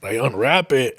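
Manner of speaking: ordinary delivery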